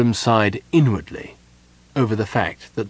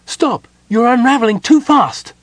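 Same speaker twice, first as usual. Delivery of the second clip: high, loud frantic gnome; alarmed cry; 13 semitones higher, 8 dB louder than usual. A narrator's reading. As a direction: cry out to halt someone at once. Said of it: "the gnome cried".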